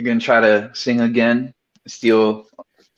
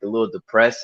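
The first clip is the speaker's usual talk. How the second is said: ordinary talk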